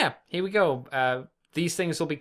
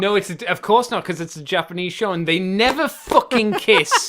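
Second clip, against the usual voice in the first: loud, emphatic exasperation building to a punched NEVER; mock-outraged rant; 3 semitones higher, 7 dB louder than usual. Co-host ranting that the show's characters never kiss, mock outrage. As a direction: rant in playful indignation, hitting the swearing hard.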